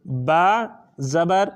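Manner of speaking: با زبر said slowly, syllable by syllable; slow spelling out. Shown as a demonstration. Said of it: "ہجا ہے". (آہستہ ہجا کرتے ہوئے)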